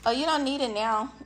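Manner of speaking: ordinary talk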